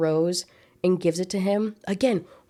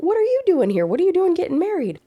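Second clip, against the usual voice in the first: mock shocked retort; 12 semitones higher, 5 dB louder than usual. [name]'s mock indignant retort.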